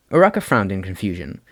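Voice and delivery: usual speaking voice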